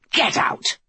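high-pitched, restrained narrated scream; screamed order. A narrator's reading. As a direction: snap it high, a contained scream. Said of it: "the Queen screamed".

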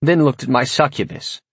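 usual speaking voice

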